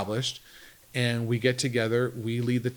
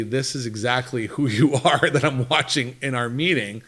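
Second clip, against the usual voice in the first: laughing through the words; 2 semitones higher, 7 dB louder than usual. Retold or imitated. Retold meeting comment, laugh heard.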